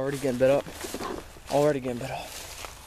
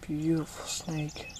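excited wildlife host whisper; 8 dB quieter, much slower than usual. Real, not acted. Admiring snake up close.

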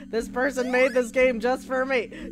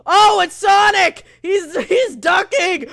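loud, higher exclamation; excited shout; 4 semitones higher, 7 dB louder than usual. Real, not acted. Player spots Sonic in the game, excited shout. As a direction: cry out on spotting a favourite.

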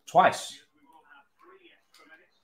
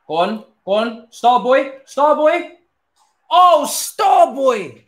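excited cheering; 12 semitones higher, 12 dB louder than usual. Real, not acted. Gamer urging on his star boy, high excited cheers.

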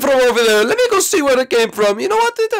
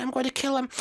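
usual speaking voice

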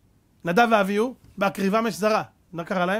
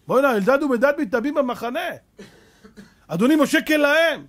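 higher, louder tattling cry; acted urgent alarm; 5 semitones higher, 4 dB louder than usual. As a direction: call out the scandal to your master. Acts alarmed report to Moses.

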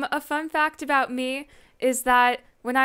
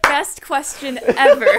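laughing; 2 semitones higher, 10 dB louder than usual. Real, not acted. Laughing at the question.

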